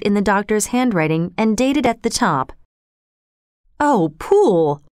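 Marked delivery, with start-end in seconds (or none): 3.8-4.8 slow, drawn-out exclamation; narrator's acted outcry, stretched out; astonished cry. A narrator's reading. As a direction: cry out the name in sudden realization. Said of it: "the lawyer cried".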